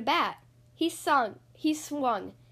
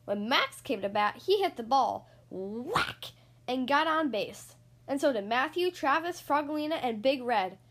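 ordinary delivery, nothing marked

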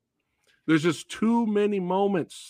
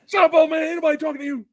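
high, loud mock yell at the old man; hammed-up boxer's shout at Mickey; imitated Clubber shout; 11 semitones higher, 7 dB louder, much faster than usual. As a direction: bark it like the brash challenger.

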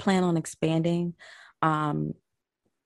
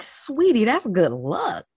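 put-on higher, slow, loving old lady voice; imitating godmother; 5 semitones higher, 4 dB louder, much slower than usual. Granddaughter retells how her godmother soothed her, higher and slow. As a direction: stretch it out sweetly like a doting granny.

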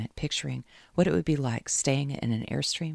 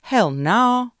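Southern hell no, much higher and louder; southern flourish; 11 semitones higher, 12 dB louder than usual. Acted. Caroline answering for Kate with a loud Southern hell no. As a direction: fling a Southern twang on the end. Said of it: "with a southern flourish at the end".

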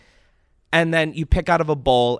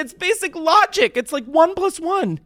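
much higher and louder, fed-up lecturing; exasperated rant; 6 dB louder than usual. Real, not acted. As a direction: hammer home the obvious in exasperation. Ranting about grammar pet peeve.

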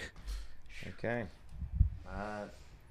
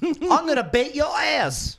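mock tough guy; 9 semitones higher, slower than usual. Mocking tough guy barking threats.

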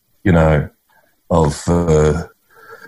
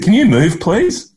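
high, raised call across a street; 11 semitones higher than usual. Retold or imitated. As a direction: call out to someone far off, politely. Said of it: "and this woman said".

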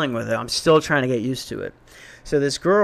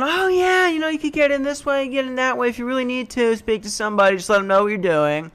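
much higher, chirpy clueless helper voice; naive suburban helper; 9 semitones higher than usual. Retold or imitated. Guiding suspicious strangers around the building, cheerfully clueless. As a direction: sing it out like a friendly oblivious neighbour. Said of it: "Suburban Joe is not thinking".

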